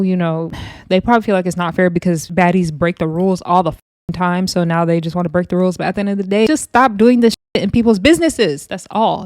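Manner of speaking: usual speaking voice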